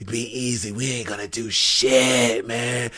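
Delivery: low, soft, drawn-out reassurance; Moxley's real talk. Retold or imitated. Wrestler calming his eager opponent, low soft imitation. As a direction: imitate him slow and very low. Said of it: "That's how he really talk".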